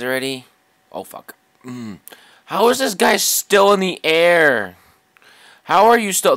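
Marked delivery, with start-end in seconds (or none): 2.5-4.8 much higher and louder, slow, disbelieving; exasperated shout. Real, not acted. Gamer yelling at an enemy plane still flying, exasperated shout. 5.6-6.4 exasperated shout. Real, not acted. Gamer yelling at an enemy plane still flying, exasperated shout.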